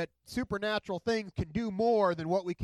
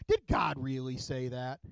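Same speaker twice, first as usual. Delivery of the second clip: lightly voiced devil; 5 semitones lower, slower than usual. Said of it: "he says this to her".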